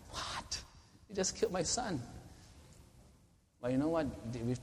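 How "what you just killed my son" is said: hushed then high, disbelieving; a stunned, half-whispered protest; lightly voiced shocked parent; incredulous. Imagined parent's incredulous reaction.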